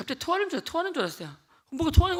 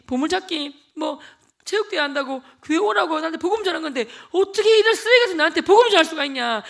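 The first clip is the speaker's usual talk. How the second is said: louder, higher, voice breaking toward tears; impassioned tearful plea; 5 semitones higher, 10 dB louder than usual. Retold or imitated. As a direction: pour your heart out to your friend. Tearful passionate speech to friend. (울먹이며 친구에게 뜨겁게 고백)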